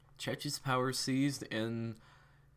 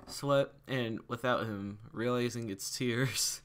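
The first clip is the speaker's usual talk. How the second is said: ordinary delivery, nothing marked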